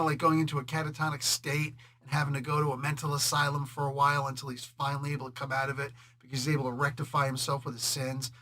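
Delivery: ordinary talk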